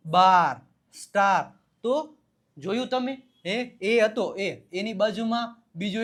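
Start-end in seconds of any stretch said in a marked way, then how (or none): 0.1-1.6 બાર and સ્ટાર loud, with the aa held; long aa sound. Shown as a demonstration. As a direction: hit and stretch the aa in બાર, સ્ટાર. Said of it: "આ આવ્યો". (બાર, સ્ટાર માં આ પર ભાર)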